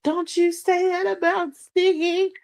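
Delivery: high squeaky defensive friend voice; mock outraged friend. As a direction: play an indignant superfan defending Stiggy, high-pitched. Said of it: "Why she get up here and be like".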